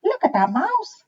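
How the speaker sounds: monkey shouting at the mouse. A narrator's reading. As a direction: call it out like a monkey. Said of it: "shouted a ma-, monkey".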